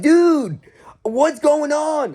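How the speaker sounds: high, loud, drawn-out plea; exasperated disbelief. Retold or imitated. Worker reacts to kid freaking out, high exasperated plea. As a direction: cry out in baffled protest, stretching the words. Baffled reply to kid's outburst.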